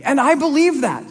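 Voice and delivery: ordinary delivery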